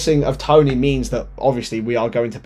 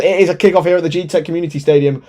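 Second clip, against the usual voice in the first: quick correction; 3 semitones higher, faster than usual. Real, not acted.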